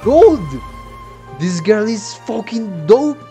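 loud, high burst of excitement; hyped shout. Real, not acted. Fan reacting to a favourite new character, excited shout. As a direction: shout it out, thrilled.

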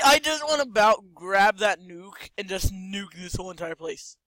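usual speaking voice

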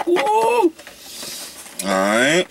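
high whoop cut into words; excited woo. Real, not acted. Hyped before opening the pack, a high whoop. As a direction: let the whoop leap high.

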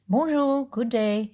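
heavy French accent, lightly voiced; a hint of a French commissioner; thick French accent. A narrator's reading. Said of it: "His accent was thick French".

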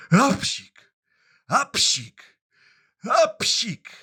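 three high, loud sneezes, ap-sik; three acted sneezes. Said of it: "I znowu kichnął trzy razy".

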